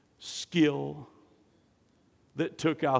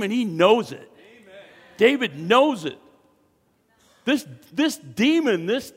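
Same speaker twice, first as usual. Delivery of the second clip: loud, high, ringing preaching; full-force preaching; 6 semitones higher, 8 dB louder than usual. Acted. Pastor exulting over David's authority, preaching loudly. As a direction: drive it home to the congregation.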